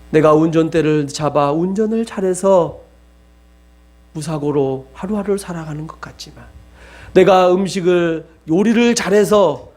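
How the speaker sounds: ordinary talk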